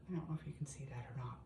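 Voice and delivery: fast whispered aside; quick whisper. Real, not acted. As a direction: rush it under your breath. Doubtful aside about camera view.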